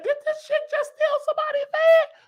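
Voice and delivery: can't believe what he's seeing; shocked disbelief. Retold or imitated. Reacting to her pulling off in a van, stunned.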